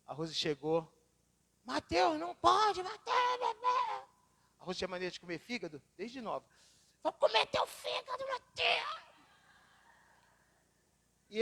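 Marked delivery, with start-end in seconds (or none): 1.6-4.1 much higher, louder sing-song scolding; high piping mom voice calling Mateus; mother calling the child. Retold or imitated. Rose scolds the toddler. 7.0-9.1 very high mock-menacing voice; high silly monster threat to the toddler; playful threat. Rose teases child playfully.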